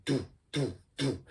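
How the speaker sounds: soft, quick do beats with puckered lips; quick do kick. Shown as a demonstration. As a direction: quietly pop out quick do beats. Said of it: "saying do really quickly".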